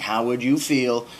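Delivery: slow, loud, self-righteous How would you feel; mock a preachy scold. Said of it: "self-righteous, preachy, like".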